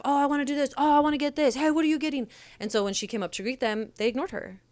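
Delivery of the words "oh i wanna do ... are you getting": rowdy diners calling out, higher and louder; mock yelling. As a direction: imitate a rowdy table yelling over each other. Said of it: "They all were yelling stuff".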